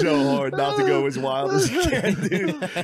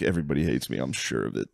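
low, soft self-pitying mumble; mock gloomy low mutter; 13 semitones lower, 5 dB quieter, faster than usual. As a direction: sink into hungover shame. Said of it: "I'm like".

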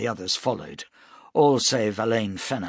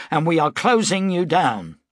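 angry declaration; 7 semitones higher, 6 dB louder than usual. A narrator's reading. Broom accusing Weber.

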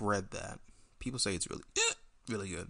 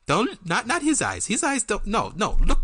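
alien overlord; 9 semitones higher, 8 dB louder than usual. Acted. Puppet scolds wrong gaze.

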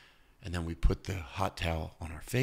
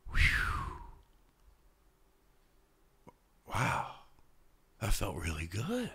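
relaxed relief; 2 semitones higher, slower than usual. Retold or imitated. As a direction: exhale into calm wonder. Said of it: "if they really go".

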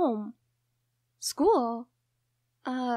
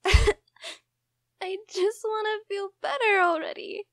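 tearful plea; slower than usual. Acted. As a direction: plead it through sobs. Sobbing to a friend who comforts.

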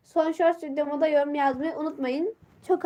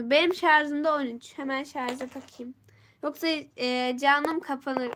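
usual speaking voice

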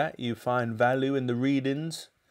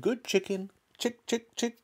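high, light sing-out to an animal; coaxing; 10 semitones higher, 4 dB quieter than usual. A narrator's reading. George calling the hen to the spoon, coaxing. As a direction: lure it over in a raised little voice.

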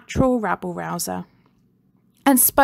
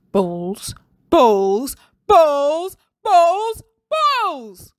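rising from plain to high, loud furious yells; explode, louder each time; building angry shout; 7 semitones higher, 6 dB louder, much slower than usual. A narrator's reading. Furious teacher shouts at the ballless playground, building. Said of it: "he kept shouting over and over".

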